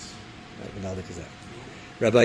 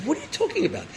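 mock indignation, pitch leaping up; angry outburst; faster than usual. Officer offended by the rabbi's chutzpah, angry outburst. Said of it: "he's so angry at him".

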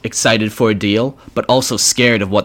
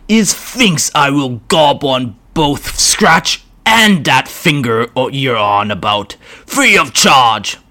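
higher, slow growling orc boss; a brutish orc taking his time; 4 semitones higher, 3 dB louder, slower than usual. Acted. Orc voiced in story.

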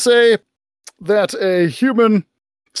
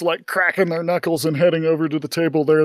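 usual speaking voice

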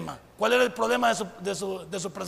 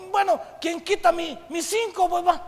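mock student; 8 semitones higher, 4 dB louder than usual. Mocking a student settling for little.

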